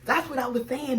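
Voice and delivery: ordinary talk, nothing marked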